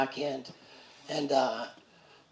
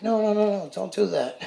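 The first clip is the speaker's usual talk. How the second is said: higher, louder urgent no no no; nurse stopping him; 5 semitones higher, 3 dB louder than usual. Retold or imitated. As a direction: nurse hurrying to stop the patient. Nurse stops him ripping cap.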